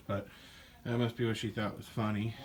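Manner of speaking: ordinary delivery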